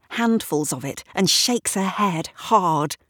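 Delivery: plain reading voice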